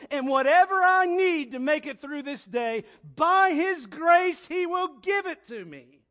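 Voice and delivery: impassioned proclaimed prayer; rising prayer of faith. Acted. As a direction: declare it like a triumphant prayer.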